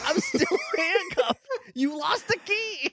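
high, slow, laughing Mime voice. Retold or imitated. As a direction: giggle through a squeaky whine. Player voices Mister Mime.

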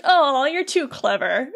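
drawn-out mock admiration. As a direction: tease with a slow, sing-song "oh". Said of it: "she kinda starts mocking him".

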